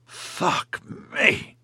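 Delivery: high, breathy, airy outburst; stunned exclamation. A narrator's reading. Oscar hears his thousand-year sentence, stunned exclamation. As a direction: gasp it out high, in disbelief.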